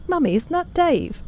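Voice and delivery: put-on high, eager little kid pointing something out; childlike mock surprise; child's voice. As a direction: squeak it like a young child spotting someone. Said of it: "was like".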